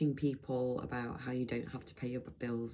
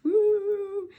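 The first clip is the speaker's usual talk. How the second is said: very high, loud "woo"; playful whoop; 11 dB louder than usual. Real, not acted. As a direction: let out a high-pitched whoop. Playful whoop after a joke.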